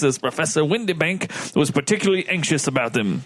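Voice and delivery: formal officer giving testimony; a hint of stiff naval officer; lightly voiced ship's lieutenant. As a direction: read it out as a lightly acted witness statement. Restarts lieutenant's testimony in role.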